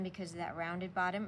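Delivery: normal talking voice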